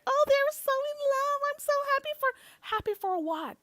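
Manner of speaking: very high, squealing mock fangirl voice; mocking the fans who gush over the couple, in a squealing fangirl voice. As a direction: squeal it like a giddy fan, mocking them. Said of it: "That is not normal behavior".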